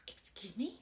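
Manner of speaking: breathy, stunned whisper; mother whispering her outrage at an insult; offended whisper. Acted. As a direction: hiss it under your breath in disbelief. Offended reply to a rude remark.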